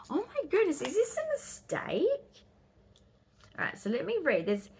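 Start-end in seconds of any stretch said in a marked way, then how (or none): 0.1-2.4 much higher with real surprise; surprised. Real, not acted. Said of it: "surprised at empty pages".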